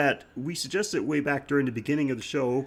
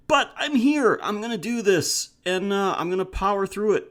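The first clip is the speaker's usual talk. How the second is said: triumphant; 6 semitones higher, 3 dB louder than usual. Real, not acted. Powering through a cold.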